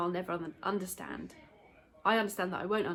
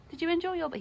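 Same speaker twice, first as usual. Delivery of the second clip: pet talk; 9 semitones higher, faster than usual. Real, not acted. Talking to the dog.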